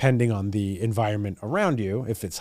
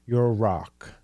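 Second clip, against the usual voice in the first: ordinary talk, nothing marked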